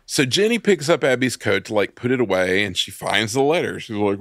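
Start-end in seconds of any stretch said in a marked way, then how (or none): none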